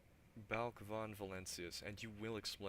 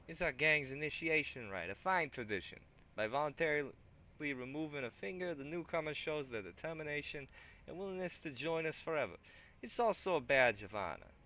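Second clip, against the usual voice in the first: higher, louder put-on thug; 7 semitones higher, 9 dB louder than usual. Thug eyes the weapon rack, loud put-on voice. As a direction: keep the thug voice going. Thug explains the finger rite.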